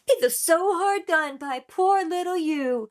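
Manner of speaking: mock-pitying, drawn out and high; sarcastic. Reactor imitating the rapper's sarcastic line, mock pity. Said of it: "the way he used sarcasm for those two lines".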